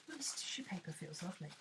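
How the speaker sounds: quick delighted whisper. Real, not acted. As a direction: whisper it as you feel the paper. Touching tissue paper, hushed.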